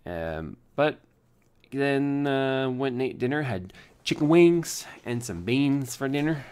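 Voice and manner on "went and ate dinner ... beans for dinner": a hammed-up bad Southern American accent. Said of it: "It's a very bad Southern accent".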